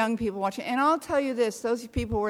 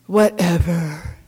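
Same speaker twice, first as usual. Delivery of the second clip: slow, louder, bitterly dismissive "Whatever"; 3 semitones lower, 6 dB louder, slower than usual. Retold or imitated. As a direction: drag out "Whatever" like a sulky shrug at God. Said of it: "with this enormous bitterness".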